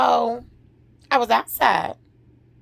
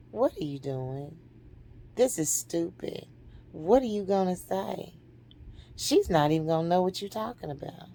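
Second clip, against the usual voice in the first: low, soft discouraging inner voice; the enemy's voice; 3 semitones lower, 7 dB quieter than usual. Retold or imitated. The enemy talking her out of approaching mother, quiet discouragement. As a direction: undermine quietly, pitched down. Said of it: "the enemy say".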